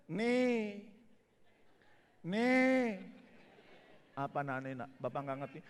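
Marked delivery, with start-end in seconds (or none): 0.1-3.0 put-on child's babbling voice; lightly voiced toddler; a hint of a toddler pointing; imitating small child. Imitating mute child's speech.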